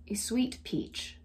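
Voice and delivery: soft, slow standard accent, clean ee; underplayed, careful non-Southern accent. Shown as a demonstration. Trying sweet-talk without twang, non-Southern accent. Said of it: "It just doesn't sound right".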